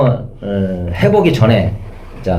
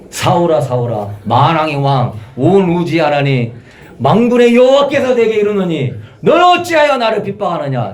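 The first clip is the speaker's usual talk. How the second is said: almighty God proclaiming; 5 semitones higher than usual. Acted. Preacher playing God confronting Saul, hammed-up majestic proclamation. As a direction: thunder it like the King of kings. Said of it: "내가 하나님 같았으면은".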